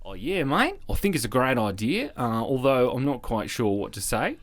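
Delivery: a lightly put-on Australian accent, mate; breezy bad Aussie impression. Cat version of himself in an Australian accent. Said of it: "Such an amazing Australian accent".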